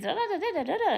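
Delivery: high laughing da's like mom's scolding; mock angry mom chatter. Imitating her angry mom's rapid scolding. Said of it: "when my mom get angry".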